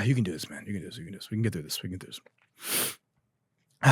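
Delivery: coaxing himself to keep composure; quiet self pep talk. Real, not acted. Host trying to stop laughing, quiet self pep talk. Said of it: "lock in, lock in".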